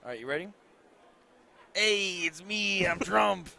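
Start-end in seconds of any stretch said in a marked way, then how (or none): 1.7-3.5 bad, slow, louder mock Trump; Trump impression. Co-caster filling a break, doing a bad Trump impression. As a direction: ham up a terrible Trump. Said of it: "I've been working my Trump impersonation".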